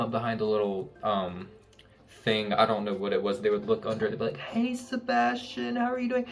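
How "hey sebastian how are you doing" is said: cutesy greeting. Retold or imitated. Servers peeking under to greet Sebastian, high cutesy greeting.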